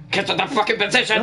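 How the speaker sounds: hammed-up urgent order; mock game callout. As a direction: call it out like an order to the team. Repeating a game order for laughs.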